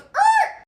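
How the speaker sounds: loud, high "errr" car noise; imitated screech. Storyteller mimicking officer pulling up, high screech. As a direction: screech like a car braking hard.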